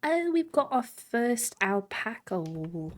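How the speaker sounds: ordinary talk, nothing marked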